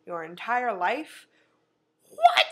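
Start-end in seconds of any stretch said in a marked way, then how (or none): 2.1-2.5 shrill disbelieving shriek, much higher and louder; shrieked what. Real, not acted. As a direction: squeal it in shock.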